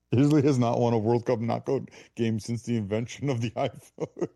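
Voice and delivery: tickled, chuckling delivery; amused laughing. Real, not acted. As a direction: laugh through the statistic. Laughing at an absurd statistic.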